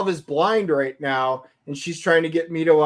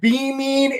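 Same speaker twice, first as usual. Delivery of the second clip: normal talking voice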